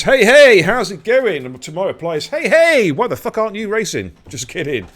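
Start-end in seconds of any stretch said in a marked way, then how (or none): 0.0-1.5 very loud, high hollered hello; exaggerated high-pitched shout; hearty shouted greeting. Reads chat greeting as big shout. 2.3-4.2 loud, high joking holler; playful high-pitched yell; mock-outraged shout. Mock reply shouted, then just kidding.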